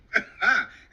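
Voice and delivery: a sudden loud burst of laughter. Real, not acted. Laughing out loud at the midday head comment. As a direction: let out a big loud laugh.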